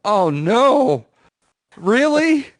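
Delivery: high, loud fake dismay; mock alarm. Retelling a reply to the insurance scammer, mock alarm. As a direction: play shocked and gullible, voice leaping up.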